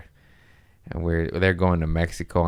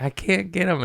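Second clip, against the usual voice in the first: put-on higher, whiny girlfriend voice; imitating girlfriend; 11 semitones higher than usual. Sadie failing to grab a mint, whiny and high. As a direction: squeak it out like she's helpless. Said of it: "she's like".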